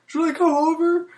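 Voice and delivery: put-on high, loud, eager girlfriend voice; mimicking girlfriend asking to come over, high and eager; girlfriend's eager question. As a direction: pitch her offer way up, keen to come over. Girlfriend voiced in retold text.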